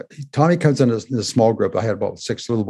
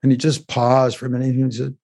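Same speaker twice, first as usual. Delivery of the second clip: ordinary talk, nothing marked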